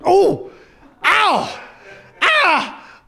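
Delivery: high, loud cries of pain; acted pained yelps. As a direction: yelp sharply in three separate bursts. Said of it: "suddenly feels an insertion".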